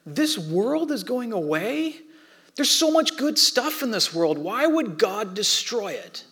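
put-on high, pleading voice of a tearful twelve-year-old girl; imitating his daughter, high and distressed; upset daughter. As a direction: plead like a hurt kid who can't believe it. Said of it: "And she goes".

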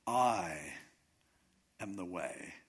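"I" held alone and stretched, then a pause before a low "am the way"; stress on I. Shown as a demonstration. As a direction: lean on "I", pause, let the rest drop away. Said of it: "just emphasize the first word".

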